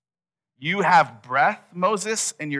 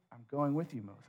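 very soft, unmoved promise; calm the objection quietly; gentle reassurance; 15 dB quieter than usual. Acted. God reassuring Moses over his objections, gentle reassurance.

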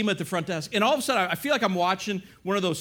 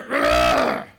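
low, guttural growl in imitation; loud growl; 6 dB louder than usual. Gym guy warns off an intruder, growling. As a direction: growl like an angry gym stranger. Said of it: "I hear someone growl behind me".

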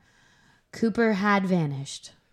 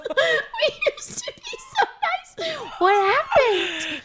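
laughing; much slower than usual. Real, not acted. Laughing lament over crude jokes.

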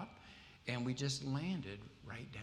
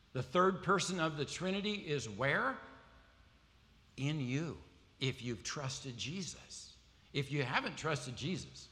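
louder and higher, ringing preacher build; preaching at full force; 4 semitones higher, 4 dB louder than usual. Acted. Preacher rouses the congregation about God within, at full force. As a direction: lift it up for the whole congregation.

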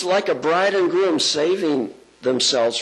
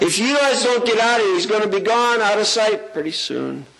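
excited urging; 9 semitones higher than usual. Pretend. Urging congregation, Jesus passing.

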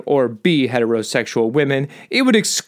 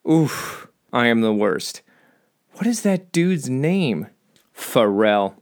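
normal talking voice

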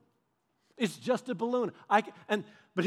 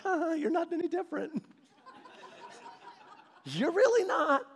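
high, laughing teasing reply; amused God; 9 semitones higher than usual. Retold or imitated. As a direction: chuckle it out, gently teasing. God amused by our tantrum.

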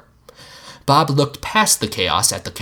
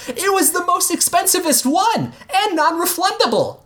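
put-on kid shouting. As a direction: call it out like an excited boy. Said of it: "Jean shouted".

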